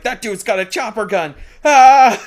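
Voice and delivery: high, loud panicked yell; panicked enemies. Pretend. Enemies fleeing the gunship, screaming in panic. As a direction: scream like a terrified soldier. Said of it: "They're like".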